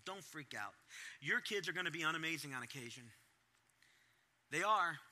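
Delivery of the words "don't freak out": Don't freak out, said again much softer; quiet, firm echo; softer repeat. Shown as a demonstration. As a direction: drop the voice and let it land.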